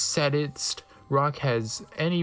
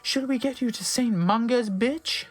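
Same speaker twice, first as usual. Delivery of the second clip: put-on high girly voice; 8 semitones higher than usual.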